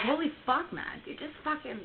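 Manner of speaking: a half-performed startled outburst; retold shock. Driver startled by the passing transport, exclaiming in shock. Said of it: "I'm like".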